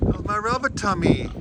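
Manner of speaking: high cooing pet voice. Real, not acted. Farmer cooing at her animal in high baby talk.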